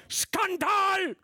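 very high, very loud outraged cry; shout. A narrator's reading. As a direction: hurl the word at them. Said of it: "wiederholte er".

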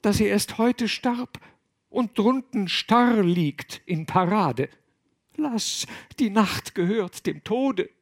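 high, pleading woman's voice; lightly breathed female objection; breathed plea. A narrator's reading. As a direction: plead with him, high and airy. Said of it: "hauchte sie".

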